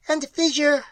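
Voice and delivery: shrill it out like a strange being; shrill creature. A narrator's reading. Creature answers Pat in a high shrill voice. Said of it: "shrilled the mystery".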